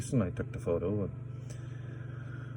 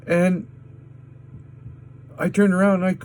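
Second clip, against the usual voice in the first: fast, loud, higher rant; heated; 9 semitones higher, 3 dB louder, much faster than usual. Real, not acted. Telling how he yelled at the crowd, fast and loud. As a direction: rush it out, worked up. Angry retelling of outburst.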